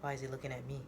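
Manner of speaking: ordinary delivery, nothing marked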